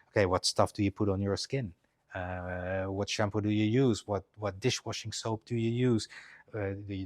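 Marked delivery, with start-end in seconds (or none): none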